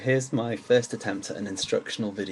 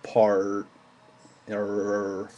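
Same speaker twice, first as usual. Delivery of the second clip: American "Part" drawn into a long R; American accent. Shown as a demonstration. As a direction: exaggerate the American R in "Part", T swallowed. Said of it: "really accentuate the R".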